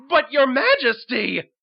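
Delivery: high, loud horrified objection; alarmed protest. Acted. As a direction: protest in shock to the king. Minister protests the order.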